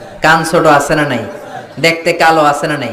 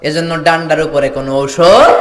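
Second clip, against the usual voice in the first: ordinary delivery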